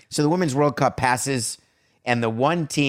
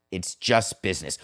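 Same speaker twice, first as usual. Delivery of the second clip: low, soft sign-off, JUST leaned on; sign-off catchphrase; 5 semitones lower, 4 dB quieter than usual. Shown as a demonstration. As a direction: drop the voice for the show's tagline. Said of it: "signature sign-off phrase".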